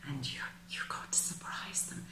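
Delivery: hushed secretive whisper. Real, not acted. Reader confiding to Capricorn, whispered. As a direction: lean in and breathe it like a secret.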